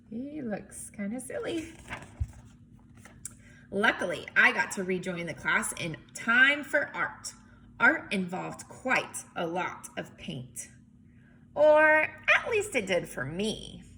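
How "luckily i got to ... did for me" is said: lively picture-book read-aloud; story narration. A narrator's reading. Teacher reading a picture book to kids, lively narration.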